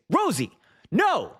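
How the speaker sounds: high, drawn-out scolding cry; retold yell. Streamer re-enacting catching his puppy, retold yell. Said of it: "I yell at her".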